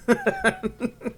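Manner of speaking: high, quick chuckle; laughing. Real, not acted. Joker to viewers after kidding about Gmail, laughing. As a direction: chuckle at your own joke. Amused at his own joke.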